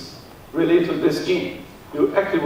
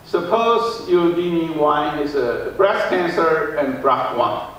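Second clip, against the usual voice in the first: ordinary delivery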